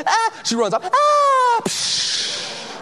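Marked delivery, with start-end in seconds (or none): 0.9-1.6 high loud yell as she launches off; leaping yell. Retold or imitated. Heidi jumping off the cliff, a high loud yell. 1.7-2.7 splash noise. Retold or imitated. Heidi hits the water.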